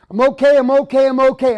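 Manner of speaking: loud, forced cheer hiding anger; forced "I'm okay". Retold or imitated. Man to family, loudly faking that he's fine. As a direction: insist you're fine, far too loudly. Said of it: "I would pretend like everything was okay".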